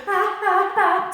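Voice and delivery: high loud panicky "ah" cries; mock panicked gasps. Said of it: "if you kind of go".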